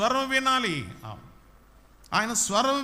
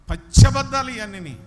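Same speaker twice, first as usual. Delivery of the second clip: పచ్చి అబద్ధాలు shouted higher and much louder; loud denunciation; 5 semitones higher, 11 dB louder than usual. Real, not acted. Preacher condemning the songs to the church, loud shout. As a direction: hammer it home to the congregation.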